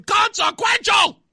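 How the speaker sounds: high, loud sarcastic yell; mock outrage shout. Host mocking overblown political stakes, sarcastic shout. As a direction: bellow it like a fake alarm.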